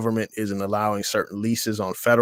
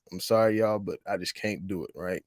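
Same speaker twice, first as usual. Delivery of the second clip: usual speaking voice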